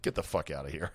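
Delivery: low, soft mock brush-off; dismissive player. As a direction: drop down into an under-the-breath dismissal. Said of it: "He's like".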